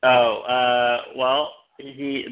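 ordinary delivery

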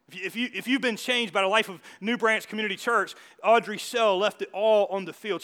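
loud, rising preacher's proclamation, slowing at the end; emphatic preaching. Acted. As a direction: lean on Audrey Shell and proclaim it.

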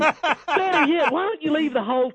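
woman's voice. Pretend. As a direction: answer like a giddy woman. Playing the woman answering.

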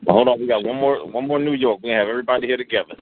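usual speaking voice

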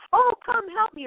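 high, pleading cry for help; helpless plea. Retold or imitated. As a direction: beg for help in a needy little voice. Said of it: "the one that says".

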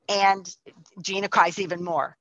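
ordinary delivery, nothing marked

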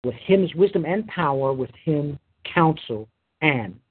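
measured, solemn Bible reading with weighty pauses; scripture reading. Acted. Preacher reading Exodus to his congregation, solemn and measured. Preacher reads Job chapter twelve.

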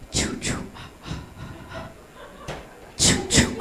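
quiet breathy choo choo; soft creepy train whistle sound; whispered train noise. Retold or imitated. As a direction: hiss a toy train sound to spook him. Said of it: "And I was just like".

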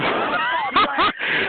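high, airy cackle; high laugh. Real, not acted. Just told the rival caller to shut up, laughing.

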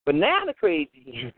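usual speaking voice

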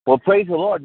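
bright, high burst of praise; joyful praise. Real, not acted. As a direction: rejoice out loud. Joyful church response.

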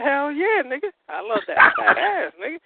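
high-pitched re-enacted enthusiasm; eager boasting. Storyteller replays his answer about liking women, hammed-up eagerness. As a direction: play up an eager yes, voice climbing high.

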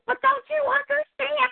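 high, loud, exasperated pleading; urgent plea. Retold or imitated. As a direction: plead with a friend who doesn't get it. Pleading retold to friend.

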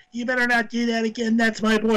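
high mock old lady scolding; shrill-sounding mock mom warning them off; imitating protective mother. Referee's mother scolding the wrestlers, high mock voice. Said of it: "she's like".

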